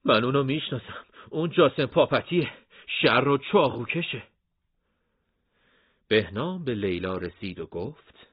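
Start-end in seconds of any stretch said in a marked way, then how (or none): 0.0-4.2 scared. Acted. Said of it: "هاشم با ترس گفت".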